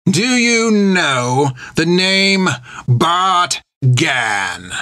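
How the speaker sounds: slow, pointed pirate captain's question; pirate captain questioning the old nun; menacing villain. A narrator's reading. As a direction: lean on it like a threatening pirate. Creech voiced in the story.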